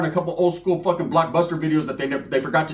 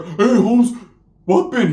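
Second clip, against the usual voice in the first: louder, higher homie call; homeboy greeting; 5 semitones higher, 6 dB louder, much slower than usual. Retold or imitated. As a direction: call out to your buddy. Primo voiced, loud.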